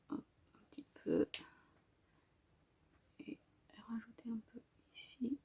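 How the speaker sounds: soft unvoiced ASMR whisper. Real, not acted. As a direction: murmur the steps under your breath. Whispering while applying makeup.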